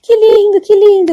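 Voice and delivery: high-pitched delighted exclaiming; happy old woman. Retold or imitated. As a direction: gush in a high, overjoyed voice, imitating her. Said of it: "e ela toda feliz". (imitando uma senhora toda feliz)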